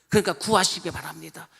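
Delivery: ordinary talk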